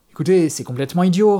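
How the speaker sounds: agitated, slightly panicky objection; hint of panic. A narrator's reading. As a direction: reason with him, panic creeping in. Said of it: "quelques beaux zestes de panique dans sa voix".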